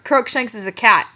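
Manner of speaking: plain reading voice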